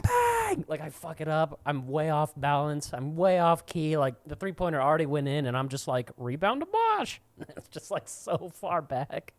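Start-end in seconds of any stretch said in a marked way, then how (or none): 0.0-0.6 high, loud sportscaster shout; hyped broadcast bang; mock announcer bang. Announcer exclamation. 6.2-7.2 high, slow broadcast call; hammed-up sportscaster line; mock commentator.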